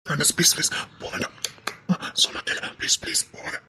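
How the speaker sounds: soft, breathy begging; whispered please, don't go; pleading whisper. Acted. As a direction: plead softly, almost whispering. Man begging her to stay.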